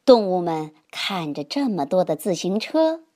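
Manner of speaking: usual speaking voice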